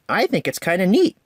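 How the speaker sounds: a hint of young Percy. A narrator's reading. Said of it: "said Percy".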